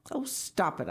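mildly annoyed protest; exasperated. Acted. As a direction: tell off a prankster on the phone. Caller fed up with quacking.

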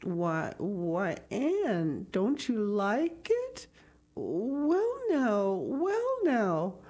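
lightly voiced hesitant man, drawing words out; falter, then stretch it with rising and falling swoops; a touch of bewildered bashfulness; shy, puzzled Matthew.

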